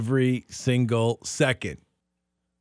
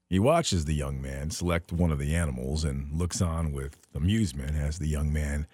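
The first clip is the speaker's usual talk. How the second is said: normal talking voice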